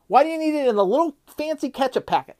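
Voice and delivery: high, indignant demand; outraged question. Real, not acted. Vlogger mocking the ketchup-packet customer, indignant. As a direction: ask it like it's the dumbest thing you've heard.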